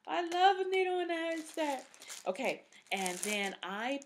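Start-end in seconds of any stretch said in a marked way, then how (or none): 0.1-1.8 high, fast, gushing; excited gush. Real, not acted. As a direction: gush about the store. Gushing about the store.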